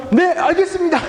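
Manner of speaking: high, brisk mock-dutiful reply; acted eager obedience. Pastor acting himself obeying his wife, high eager reply. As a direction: jump to it like an obedient husband. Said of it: "목사님 와이프가 막".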